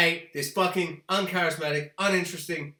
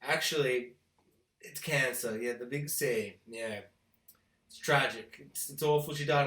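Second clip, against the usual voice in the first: lower, softer mock solemn voice; mock solemn writers; 7 semitones lower, 7 dB quieter than usual. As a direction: mimic a fake sombre tone. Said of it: "and be like".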